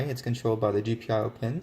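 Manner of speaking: ordinary delivery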